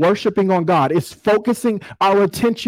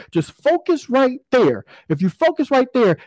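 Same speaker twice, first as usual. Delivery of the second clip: higher pitch on FOCUS RIGHT THERE; coaching emphasis; 7 semitones higher than usual. Retold or imitated. Dad steering his distracted son in a game, coaching emphasis.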